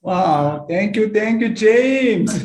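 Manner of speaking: high, loud delighted greeting; excited. Real, not acted. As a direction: burst out with happy surprise.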